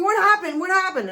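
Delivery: husband rushing in alarmed; retold panicked husband. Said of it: "comes in running and screaming".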